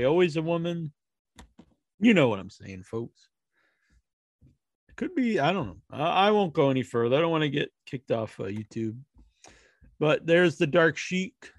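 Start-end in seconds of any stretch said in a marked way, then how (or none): none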